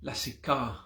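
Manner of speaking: lasika with a long AH at the end; long final A. Shown as a demonstration. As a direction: let the last A of lasika ring fully. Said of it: "the long pronounced A".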